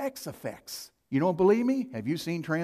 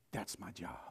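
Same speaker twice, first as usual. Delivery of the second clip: hushed whisper; 10 semitones lower, 17 dB quieter than usual. Real, not acted. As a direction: drop to a bare whisper. Whispered conviction.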